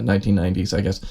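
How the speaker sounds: usual speaking voice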